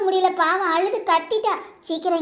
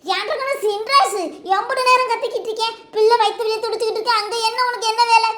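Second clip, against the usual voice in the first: raised, urgent calling out; impatient yelling; 3 semitones higher than usual. Acted. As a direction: scold and summon someone from afar. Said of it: "ஏன் இப்படி கத்து கத்துன்னு கத்துறீங்க". (அவசரமாகக் கத்தி அழைப்பு)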